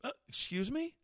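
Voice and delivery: high, soft questioning pitch; mock offended. Woman correcting her date, mock offended. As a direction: play an affronted date pulling someone up.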